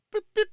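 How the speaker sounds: two short high beeps; imitated alarm chirp. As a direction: mimic a car alarm locking. Imitates a car alarm being set.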